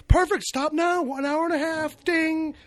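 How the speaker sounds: high, loud urgent producer. Retold or imitated. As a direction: rush them like a frantic producer. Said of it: "Palmer is such a producer".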